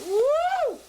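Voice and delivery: a high, loud woo at the sizzle; excited whoop. Real, not acted. Cook whoops at the sizzling peppers, excited. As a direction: let out a sudden high whoop as the pan sizzles. Peppers hit the hot pan.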